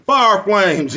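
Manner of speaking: high, loud burst of "Fire flames"; sudden high loud catchphrase; hype shout. Real, not acted. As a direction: fire it out like a cheer. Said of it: "hyping catchphrase".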